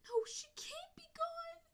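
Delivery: slow, breathless whispered cry. A narrator's reading. Kit refusing to believe mother is dead, slow whispered sob. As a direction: whisper it through tears, denying it. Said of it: "Alpine Kit cried".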